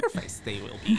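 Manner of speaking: light Yoda-style delivery, laughing after; lightly imitated Yoda voice. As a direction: play at being a wise little Jedi, half-joking. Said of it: "Okay, Yoda".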